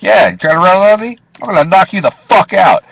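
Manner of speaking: high, loud pumped-up challenge; tough-guy threat. Pretend. Cab driver threatening future fare dodgers, loud and cocky. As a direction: dare a runner to try it.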